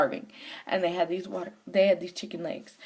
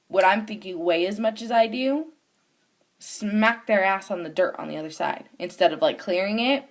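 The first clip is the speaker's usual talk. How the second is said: ordinary talk, nothing marked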